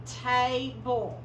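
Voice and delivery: table stretched very slowly, ta-ble drawn out; slow model. Shown as a demonstration. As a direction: drag out table for the kids to hear. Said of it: "slow model then plain".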